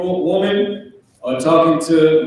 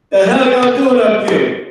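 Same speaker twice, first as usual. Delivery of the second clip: higher, raised scolding call; parent yelling upstairs; 7 semitones higher, 4 dB louder than usual. Acted. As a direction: call up to the kids as a cross parent.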